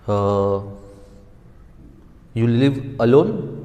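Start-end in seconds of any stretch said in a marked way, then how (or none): 2.3-3.5 "alone" rising at the end, as a question; question intonation. Shown as a demonstration. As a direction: lift the pitch on "alone" to ask. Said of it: "I'm trying to inquire or ask a question".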